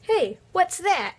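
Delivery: high startled call; alarmed shout. Acted. Townsfolk alarmed by villain.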